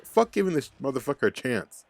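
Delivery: normal talking voice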